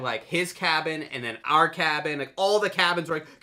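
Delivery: ordinary talk, nothing marked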